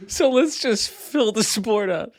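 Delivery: breaking into laughter; can't hold back the laugh. Real, not acted. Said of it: "try to say that whole thing with a straight face".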